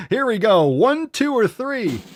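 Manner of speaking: high, loud game-show hype; building game-show excitement; hyped up. Acted. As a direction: announce it like a game host.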